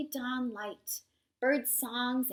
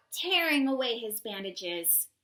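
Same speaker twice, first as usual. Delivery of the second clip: plain reading voice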